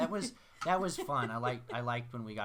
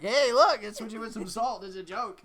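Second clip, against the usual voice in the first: high, loud prankster voice; playing the joker swapping coke; mock prankster; 9 semitones higher, 10 dB louder than usual. A friend joking about swapping coke for salt. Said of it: "they're like".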